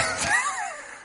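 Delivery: very high, laughing squeal; a sudden high, delighted laugh; squealing laugh of joy; joyful laugh. Real, not acted. Laughs after the shouting.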